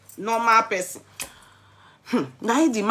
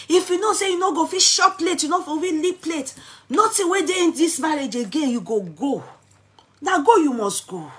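mock angry wife; 8 semitones higher than usual. Wife's angry demand acted out.

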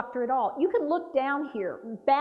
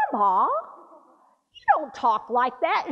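scolding grandma; 3 dB louder than usual. Retold or imitated. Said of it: "I made the mistake of saying".

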